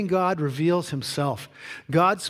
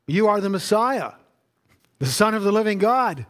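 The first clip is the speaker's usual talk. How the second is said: higher and louder, declaring it; bold confession; 5 semitones higher, 4 dB louder than usual. Acted. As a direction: proclaim it with conviction, voice lifted.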